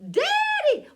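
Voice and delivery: very high, loud panicked cry; screeching. A narrator's reading. Boy running to the house for his daddy, screeching. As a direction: screech for help like a spooked boy.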